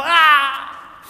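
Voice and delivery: loud high cry of pain; imitated scream. Woman grabbing on the rails, screaming. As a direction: wail out like someone hurt.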